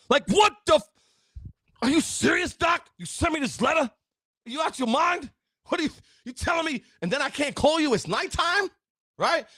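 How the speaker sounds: mock outrage, pitch way up; freaking out. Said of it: "You can freak out".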